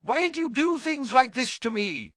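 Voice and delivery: high, whining complaint; whiny. A narrator's reading. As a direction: whine like a put-upon potions master. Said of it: "the whiny potions master inquired".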